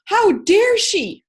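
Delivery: slow, high mock "How dare she?"; indignant. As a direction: ham up the outrage. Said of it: "they're like".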